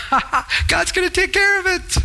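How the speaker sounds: a woman laughing at her future; carefree laugh. Retold or imitated.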